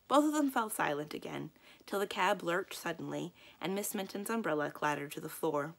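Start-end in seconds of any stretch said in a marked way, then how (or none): none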